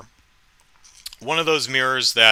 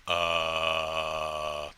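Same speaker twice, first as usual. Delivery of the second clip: much lower, soft dull groan; low zombie groan; 8 semitones lower, 10 dB quieter than usual.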